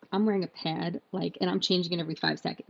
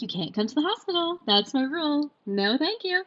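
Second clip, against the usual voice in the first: bossy rule-setting; 8 semitones higher, 4 dB louder than usual. Retold or imitated. Laying down rule to mom.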